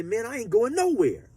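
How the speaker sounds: high and loud, standing his ground; defiant refusal. Retold or imitated. New inmate refusing to move for the gangster, defiant. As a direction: plant your feet and refuse. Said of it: "I tell him, I say".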